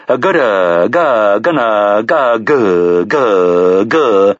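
robot stuck on a syllable, repeating; stuttering robot. A narrator's reading. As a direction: stammer like a glitching machine. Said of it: "said the robot".

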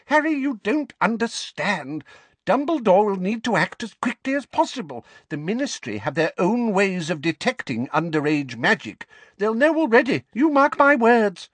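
put-on high, insistent old lady voice; urgent old woman. A narrator's reading. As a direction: press it on the boy urgently. Figg urging.

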